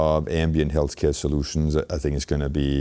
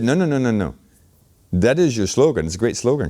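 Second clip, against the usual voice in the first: mock coach's objection, pitched up; emphatic correction; 7 semitones higher, 4 dB louder than usual. Executive coach rejects his value proposition, retold as emphatic correction. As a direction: retell a firm, higher-pitched rebuff.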